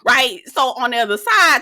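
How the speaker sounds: ordinary delivery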